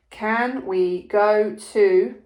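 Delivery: slow dictation. Shown as a demonstration. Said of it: "dictating while writing".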